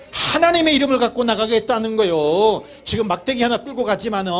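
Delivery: high, sustained preacher's declaration; emphatic preaching. Real, not acted. Preacher explaining David going out in God's name, emphatic preaching.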